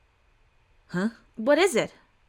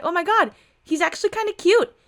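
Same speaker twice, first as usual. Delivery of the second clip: put-on gushing girl; 9 semitones higher, 3 dB louder than usual.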